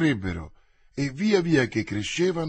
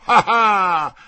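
loud, raised triumphant "ha ha"; loud exclamation; 5 semitones higher, 9 dB louder than usual. A narrator's reading. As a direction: exclaim it boldly in mid-fight. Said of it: "esclamò Porthos".

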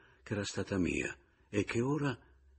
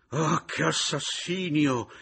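slow, high, loud wail; drawn-out scandalized shriek; outcry; 10 semitones higher, 8 dB louder, slower than usual. A narrator's reading. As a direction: cry out in horror. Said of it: "gridò la pescatore".

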